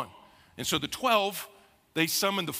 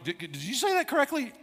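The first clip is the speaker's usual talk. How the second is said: high, put-on doubtful voice; hammed-up disbelief at the pronunciation; incredulous; 9 semitones higher than usual. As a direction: tease yourself as a skeptical listener. Mock listener doubts his pronunciation.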